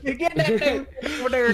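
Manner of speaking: high, laughing Western twang. Retold or imitated. Joining the joke about his own accent, playful Western twang. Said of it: "a Western twang on it".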